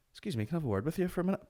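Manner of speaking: high, quick, courteous approach; mock-polite excuse me; falsely polite request. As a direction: ask sweetly and rapidly, luring someone over. Pretend polite approach before the kick.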